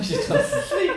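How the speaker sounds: breaking up into high laughter; real laughing. Real, not acted. Streamer teasing a sleepy co-host, laughing. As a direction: crack up while saying it.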